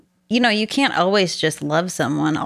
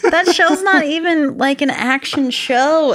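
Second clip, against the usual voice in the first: incredulous retort; 9 semitones higher, 4 dB louder than usual. Retold or imitated. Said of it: "And I was like".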